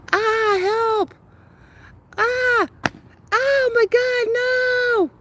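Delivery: very high, loud panicked yells; real frightened shouting. Real, not acted. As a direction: scream for help in real panic. Dog chasing bird at night.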